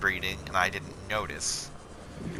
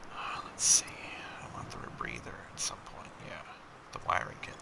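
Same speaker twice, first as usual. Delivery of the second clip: soft unvoiced whisper while browsing; whispering to self; 9 semitones lower, 12 dB quieter than usual. Real, not acted. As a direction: whisper it to yourself, thinking aloud. Muttering to self while shopping.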